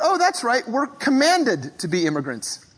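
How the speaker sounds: ordinary delivery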